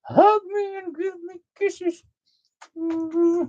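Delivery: very high, cooing puppy voice for the dog; voicing the dog. Owner voicing the puppy's wishes, high cooing baby talk. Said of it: "she says".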